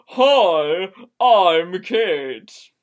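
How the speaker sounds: loud, pointed hello; loud greeting. Acted. Kate cutting in to introduce herself to Caitlin, loud. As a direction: announce yourself firmly.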